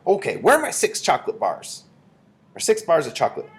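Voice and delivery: high-pitched bratty demand; demanding boy. A narrator's reading. Bruno wants his chocolate bars, high demanding voice. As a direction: ask as a greedy, impatient boy.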